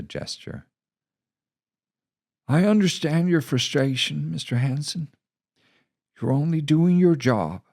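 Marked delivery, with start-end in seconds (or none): 2.5-7.6 much higher, measured and understanding; steady reassuring commander; calm commander. Acted. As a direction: soothe the angry reporter.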